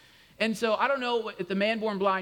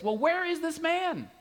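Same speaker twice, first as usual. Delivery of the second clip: suspicious neighbor voice; 10 semitones higher than usual. Retold or imitated. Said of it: "they said this".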